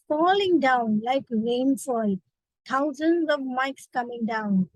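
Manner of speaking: ordinary talk, nothing marked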